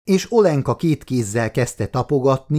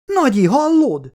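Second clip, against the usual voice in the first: put-on much higher, urgent child call; a small girl pulling at grandma's sleeve; eager little girl; 14 semitones higher, 4 dB louder than usual.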